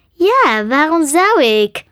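exaggerated huffy question; pouting. A narrator's reading. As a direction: pout it like a put-out dragon. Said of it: "zei Sam pruilend".

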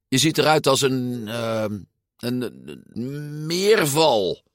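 stall, then stretch out the insult; groping for words. A narrator's reading. Giulia invents a new retort, groping for words.

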